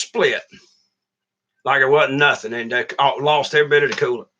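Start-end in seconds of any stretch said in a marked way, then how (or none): none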